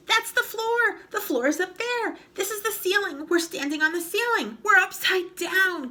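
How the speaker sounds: high, loud alarmed cries; panicked gasp. Acted. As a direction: panic at being upside down. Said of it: "gasped Mister Twit".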